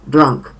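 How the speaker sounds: put-on drunk with a short "a" sound, falling. Shown as a demonstration. Teacher models the third form, short-vowelled drunk. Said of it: "krótkie A".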